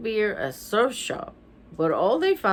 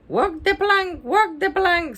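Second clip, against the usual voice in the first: very high parrot squawk; high, squeaky voice; 10 semitones higher, 3 dB louder than usual. A narrator's reading. Parrot calling from the pet shop, high and squeaky. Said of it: "It was high, squeaky voice".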